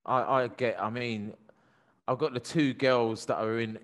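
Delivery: usual speaking voice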